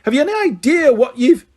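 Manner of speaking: high, loud furious start; bellow. Acted. McGonagall bellowing at the boys, cut off. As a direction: roar the question. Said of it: "bellowed Professor McGonagall".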